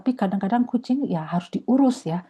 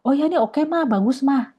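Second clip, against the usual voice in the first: daughter's approval; 7 semitones higher than usual. Retold or imitated. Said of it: "Lalu dia akan lihat itu buku itu".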